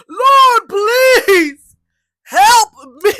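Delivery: loud, high wailing plea; crying out to God. Retold or imitated. Calling out to God, overheard outside the room door, crying out. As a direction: re-enact a desperate, tearful shout for help. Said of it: "full on crying".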